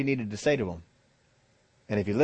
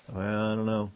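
hesitant doubter; 2 semitones lower, 3 dB quieter than usual. Acted. Doubter answers the Lord.

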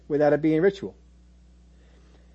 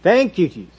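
louder, higher churchy exclamation; mock catchphrase; 4 semitones higher, 6 dB louder than usual. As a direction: toss it out like an empty pious habit. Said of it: "catchphrases like this".

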